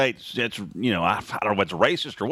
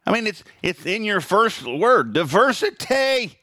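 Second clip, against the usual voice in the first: high, drawn-out diversity, pointing out the irony; incredulous sarcasm; sarcastic emphasis; 8 semitones higher than usual. Real, not acted. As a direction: stretch diversity with mocking disbelief. Sarcastic outrage at the word.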